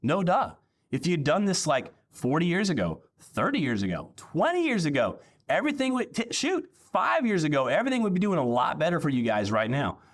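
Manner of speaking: high, mocking scold; sarcastic no duh. Pastor mocks the leaders for their late obedience. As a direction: lay on the obvious, mock exasperated. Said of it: "And I'm like".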